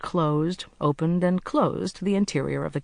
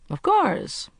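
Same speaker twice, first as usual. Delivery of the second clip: breezy, much higher lift on the line; blithe; 13 semitones higher than usual. Acted. Agent shrugging off her praise, blithely. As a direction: toss it off carelessly, pleased with himself. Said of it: "he said blithely".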